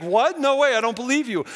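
high, loud cry of disbelief; shocked disbelief. Retold or imitated. Wife hearing of the pastor's death, high loud disbelief. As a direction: retell the wife refusing the news. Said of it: "She's like".

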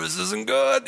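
high-pitched, uneasy realisation; mock worry. Prankster sees buddy bought in, high mock worry.

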